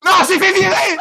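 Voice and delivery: loud laughing cry; laughing shout. Real, not acted. Fired in game.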